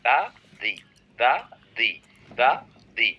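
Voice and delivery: "দা" with an open ah, "দি" with an ee; alternating THE pronunciations. Shown as a demonstration. Teacher drills both sounds of THE, alternating "দা, দি". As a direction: alternate "দা" and "দি" in separate, evenly paced pairs. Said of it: "যেটাকে আপনি দা বলেন, ওইটাকে আবার দিও বলা যায়".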